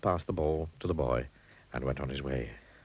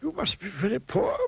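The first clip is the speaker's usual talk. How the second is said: high wizard voice, kindly; a high, gentle old wizard. A narrator's reading. Wizard speaking kindly to the poor miser. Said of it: "he remarked kindly".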